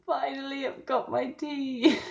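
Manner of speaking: high, slow and gleeful. Real, not acted. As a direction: savour every word with glee. Parcel of tea arrives.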